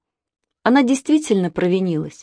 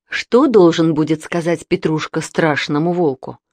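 ordinary delivery, nothing marked

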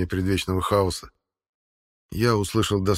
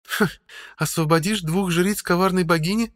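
female voice; 11 semitones higher, 3 dB louder than usual. A narrator's reading. Woman's mocking question.

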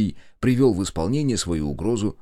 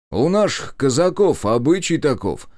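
jaunty, louder retort; brisk; 6 semitones higher, 5 dB louder than usual. Acted. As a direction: fire back cheerfully. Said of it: "бодро ответил я".

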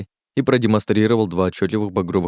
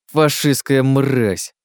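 very slow, raised, venomous insult; hissed insult; 5 semitones higher, 3 dB louder, much slower than usual. Acted. Jerome condemns Miller as a fascist, hissing slowly. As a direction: draw out the insult with loathing. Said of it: "прошипел Жером".

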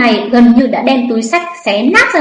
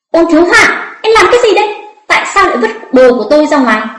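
raised, higher-pitched outraged demand; angry scolding; 5 semitones higher, faster than usual. A narrator's reading. Woman whose things were dumped out scolds him, angry. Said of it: "vừa nhặt vừa mắng người".

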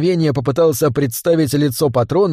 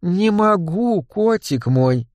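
slow, high, tipsy singsong; singsong cooing; 5 semitones higher, much slower than usual. A narrator's reading. Tipsy woman refusing gang boss, cooing singsong. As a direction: sing it out slowly, flirtatiously. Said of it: "пропела девушка".

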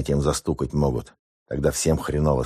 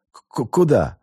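frightened stammer; 8 semitones higher than usual. A narrator's reading. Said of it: "Совершенно одуревший от страха".

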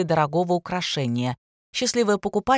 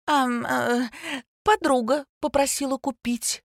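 high, hesitant improvised excuse; stall, then offer an excuse in a higher voice; wriggling out; 7 semitones higher than usual. A narrator's reading. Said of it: "выкрутилась Ника".

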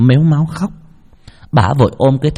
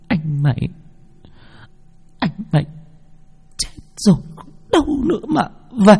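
sobbing mother; 11 semitones higher than usual. Acted. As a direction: grieve for her dead son. (giọng mẹ nức nở than khóc)

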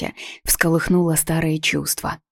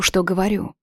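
plain reading voice

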